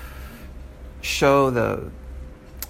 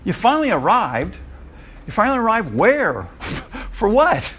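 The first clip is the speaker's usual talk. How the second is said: incredulous retort; 10 semitones higher than usual. Retold or imitated. Teacher retorting to a vague post, high incredulous. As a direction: scoff at the post with a laugh.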